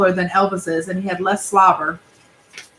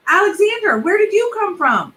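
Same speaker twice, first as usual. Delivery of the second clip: lightly voiced kid, higher, begging; pleading boy; 11 semitones higher than usual. Benji begging mom to chase the car, lightly higher. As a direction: push hard, then beg.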